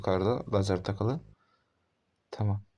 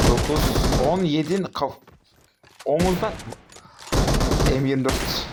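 frustrated outburst; 4 semitones higher, 4 dB louder than usual. Real, not acted. Frustrated hit count.

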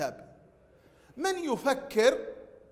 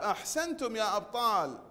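ordinary talk

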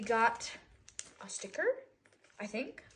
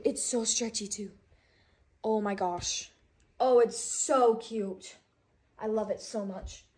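normal talking voice

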